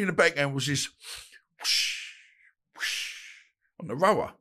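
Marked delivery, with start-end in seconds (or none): 1.6-3.1 rowing machine whoosh. Retold or imitated.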